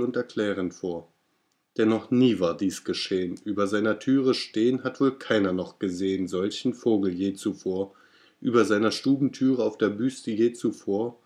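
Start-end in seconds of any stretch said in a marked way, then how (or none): none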